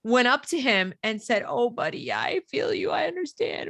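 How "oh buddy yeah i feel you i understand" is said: mock-sympathetic, high-pitched principal voice; high cooing imitation of a principal; soothing principal. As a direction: coo it high, as if comforting a small child. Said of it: "it was the principal of the school".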